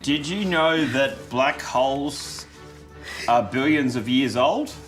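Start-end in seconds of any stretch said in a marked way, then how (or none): none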